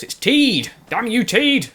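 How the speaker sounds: loud, high hammed-up curse; mock outrage. Gamer yelling in mock fury at wrong horse.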